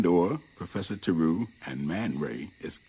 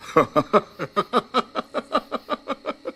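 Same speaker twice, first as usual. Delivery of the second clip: a bright, high-pitched giggle; 13 semitones higher, 4 dB louder than usual. Acted. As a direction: giggle with delight at the joke.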